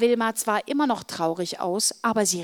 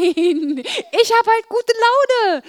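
cheerful; 13 semitones higher, 8 dB louder, much slower than usual. Acted. Cheerfully drawn out.

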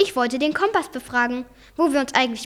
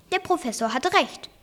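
ordinary delivery

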